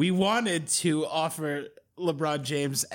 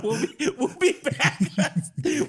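giggling through the sign-off; words breaking into laughter; laughing through words; 7 semitones higher, slower than usual. Real, not acted.

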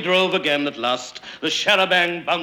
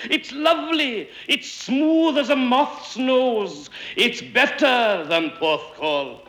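high, drawn-out delighted cries; crying out; 11 semitones higher than usual. A narrator's reading. Men paddling in the river, crying out in delight. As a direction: stretch each exclamation out high. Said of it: "they cried".

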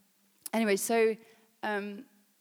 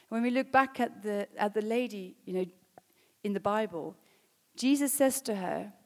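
ordinary talk, nothing marked

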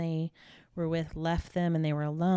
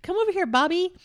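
put-on much higher and louder, calling a kid over; mock call to Bobby; 12 semitones higher, 7 dB louder than usual. Imitating a mom calling Bobby, much higher and louder. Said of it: "they don't say that".

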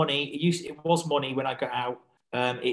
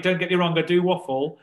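"I do waffle" said louder and higher, with weight on "do"; louder, emphatic admission; 6 semitones higher, 8 dB louder than usual. Real, not acted. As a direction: own it with a laugh in the voice.

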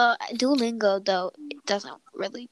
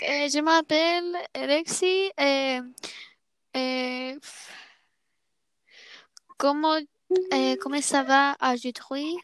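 hesitant, higher, hammed-up French accent with filler "eh"; clumsy French; 4 semitones higher than usual. To a French friend, imitating her own clumsy French accent. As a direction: fumble through schoolgirl French accent, lightly hammed. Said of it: "embarrassed myself ... trying to do French".

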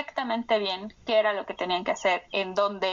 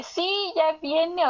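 higher, eager exclamation; emoción imitada; 7 semitones higher, much slower than usual. Retold or imitated. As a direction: act out the thrill of an imminent arrival. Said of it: "la emoción de". (emoción imitada, voz aguda e ilusionada)

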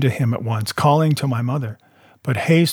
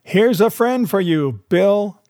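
higher-pitched announcing call; raised, sing-out call without real shouting; crying out; 7 semitones higher, slower than usual. A narrator's reading. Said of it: "cry out".

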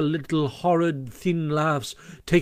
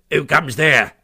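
a ferret's put-on voice, louder and sharp; sharp sentry challenge; 6 dB louder than usual. A narrator's reading. Ferret sentry challenges Toad at the gate, sharp. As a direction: bark the challenge like a guard. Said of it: "said the ferret sharply".

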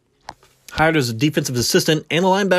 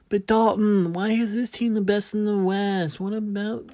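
high, soft whiny fan voice; imagined fan objecting; 7 semitones higher, 6 dB quieter than usual. Pretend. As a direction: play a doubting viewer pushing back.

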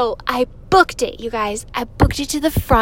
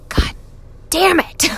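frustrated curse; 6 semitones higher than usual. Retold or imitated. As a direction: blurt it out in annoyance. Frustrated at bad seats.

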